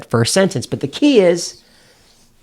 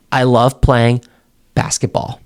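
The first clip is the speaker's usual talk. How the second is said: deliberate pause, falling close; pause before last word; 4 semitones lower than usual. Shown as a demonstration. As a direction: hold a beat before "basketball" and drop it. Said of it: "You pause and then you lower your tone".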